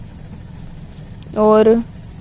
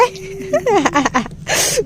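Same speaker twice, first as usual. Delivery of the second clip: high burst of giggling; amused laugh; 7 semitones higher, 4 dB quieter than usual. Real, not acted. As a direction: laugh helplessly at a bad drawing. Laughing at the drawn eye.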